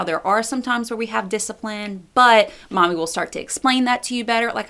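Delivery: ordinary talk, nothing marked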